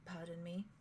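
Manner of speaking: startled, softly excusing herself; soft pardon. Acted. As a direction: murmur an embarrassed pardon. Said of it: "She's like".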